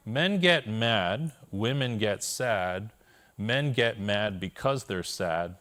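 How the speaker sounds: slow, deliberate, every word weighed; measured wise-mentor delivery; slow wise quote. Retold or imitated. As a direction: lay out the coach's wisdom slowly. Quoting coach's wisdom slowly.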